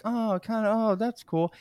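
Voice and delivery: high, mock-innocent hinting for a gift; coy hinting. Imitating the girlfriend angling for a phone, coy and high. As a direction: lightly imitate a kid fishing for a present. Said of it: "I see my kids do to me".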